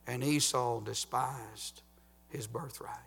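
hushed, breathy drop; whisper. Acted. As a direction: let it fall to a whisper. Hushed end of a sermon point.

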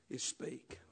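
breathy, barely voiced whisper. Real, not acted. Preacher to congregation, hushed whisper for emphasis. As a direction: drop to a whisper for effect. Dramatic hush after sermon climax.

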